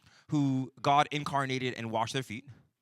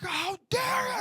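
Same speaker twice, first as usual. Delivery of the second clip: high shrieking outburst, much louder; furious shout; 6 dB louder, slower than usual. Retold or imitated. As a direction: yell it at the suspect, adrenaline pumping. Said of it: "screaming because adrenaline".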